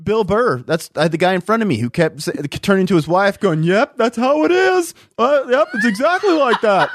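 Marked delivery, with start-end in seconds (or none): 3.6-6.9 mimicking a guy. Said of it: "That's how his voice sounded".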